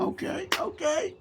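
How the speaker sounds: high squeaky okay. Real, not acted. Teasing co-host as she speaks up.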